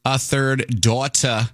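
slow, drawn-out mock British "A third daughter"; a failed stab at a British accent, saying "daughter" as "dotta"; lightly put-on English accent, slow; attempted British accent. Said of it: "that's not a U K accent".

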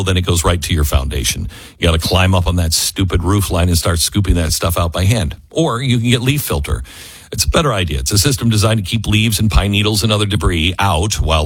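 relaxed, chatty sponsor read. Acted. Radio host reading a gutter sponsor spot, casual ad read. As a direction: sell the product like a friendly tip.

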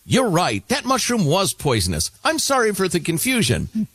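high-pitched, agreeable chatbot apology; acted AI assistant. AI in meme apologizes.

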